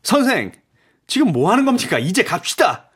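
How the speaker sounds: high-pitched impatient urging. A narrator's reading. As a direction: hurry a dawdling companion along. Raoul impatient to leave, Persian searching.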